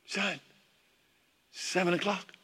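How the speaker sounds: softly voiced mother waking her son; gentle wake-up call. Mom wakes son through door.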